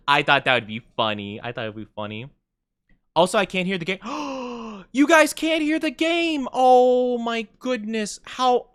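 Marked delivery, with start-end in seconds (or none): 4.0-4.9 sharp high gasp of shock. Real, not acted. Realizes stream was muted. 4.9-8.7 shocked disbelief. Real, not acted. Realizes nobody heard game audio.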